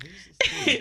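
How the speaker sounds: mock caller. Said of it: "And he's like".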